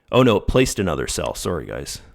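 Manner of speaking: ordinary talk, nothing marked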